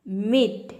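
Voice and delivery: मीट with a held ee; stressed long ee. Shown as a demonstration. Teacher lists example words for students, मीट with long ee. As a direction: stretch the vowel in मीट. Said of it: "जोर देके pronounce करना है".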